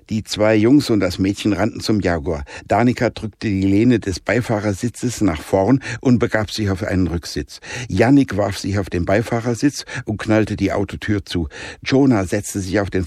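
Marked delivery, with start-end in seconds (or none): none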